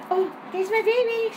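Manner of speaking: doting. Real, not acted. Greeting her dog.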